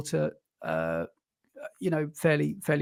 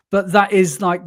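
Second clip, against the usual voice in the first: ordinary delivery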